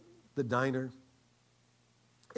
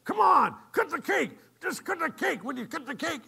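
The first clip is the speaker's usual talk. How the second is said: high, loud, insistent diner owner; impatient urging; 12 semitones higher, 8 dB louder than usual. Retold or imitated. Diner owner urging the girl to cut cake, impatient. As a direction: egg her on in a raised, high voice.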